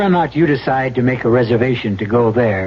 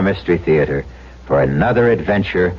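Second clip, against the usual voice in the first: host sign-off; 4 semitones lower than usual. A narrator's reading. E G Marshall closing the show, ominous. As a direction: send the listeners off with a wink of dread.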